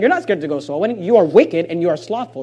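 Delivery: usual speaking voice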